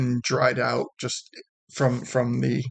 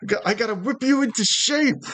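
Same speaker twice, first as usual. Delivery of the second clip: much higher, bossy drill-sergeant bravado; Romeo; 9 semitones higher than usual. Acted. Romeo berating the lazy guards with high bossy bravado. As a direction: scold the guards like a swaggering boss. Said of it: "and then he replies".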